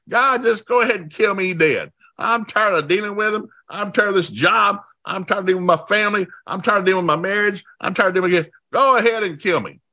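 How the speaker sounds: high, worn-out complaint; fed up and pleading to be done; exasperated Moses. Retold or imitated. Preacher acting out Moses fed up with his burden, high and weary. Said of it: "Moses is saying".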